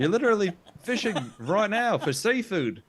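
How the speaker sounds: high-pitched, incredulous retort; mock-outraged heckling; indignant comeback. Player trades insults with sailor.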